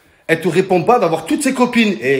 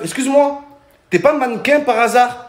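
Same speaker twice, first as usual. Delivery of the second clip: high, louder smarmy flirt; cheesy pickup line; 8 semitones higher, 4 dB louder than usual. Retold or imitated. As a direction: lay on the charm, mock-smooth. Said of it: "tu dis à la meuf".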